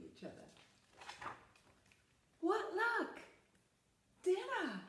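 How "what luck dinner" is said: high, loud gleeful fox spotting dinner; delighted predator voice; hungry fox. Acted. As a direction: crow over your luck as the sly fox. Fox spots the duck.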